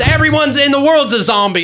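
high, hammed-up proclamation; mock leader. He mimics the leader escalating the exercise, high proclaiming voice. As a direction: announce it high like an overwrought guru.

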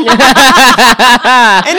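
big burst of real laughter; loud real laughter. Real, not acted. Laughing at own joke.

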